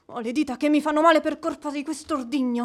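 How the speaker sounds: rapid flustered grumble; hurried complaint. Acted. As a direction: gabble it out in a fluster.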